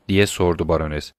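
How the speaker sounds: ordinary delivery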